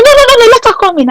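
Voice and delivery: high and fast, waving her in; eager boss voice. Retold or imitated. RSM overruling the CSM at the door: high, fast.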